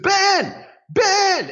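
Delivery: high, loud shout of a name across the street; screaming a name. Retold or imitated. Shouting at a Ben Franklin lookalike across the street, loud high yell. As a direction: yell after him to turn around. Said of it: "I start screaming at this dude".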